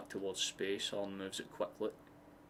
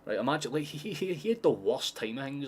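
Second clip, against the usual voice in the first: ordinary delivery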